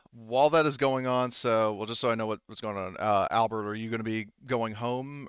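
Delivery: ordinary talk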